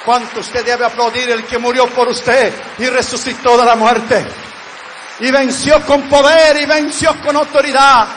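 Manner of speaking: rising to a loud, triumphant cry; impassioned sermon climax; building preaching. Real, not acted. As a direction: drive home the point with growing fervor.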